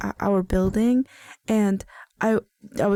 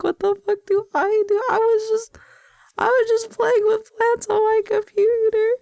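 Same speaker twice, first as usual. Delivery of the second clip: embarrassed, pitched up high; rattled and squeaky with embarrassment; flustered high panic; 11 semitones higher, 4 dB louder than usual. Real, not acted. Caught by workers at window.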